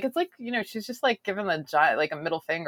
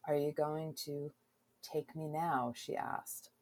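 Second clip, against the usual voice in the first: sultry reading; 3 semitones lower, 12 dB quieter than usual. Acted. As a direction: read it low and intimate. Reads romance excerpt aloud.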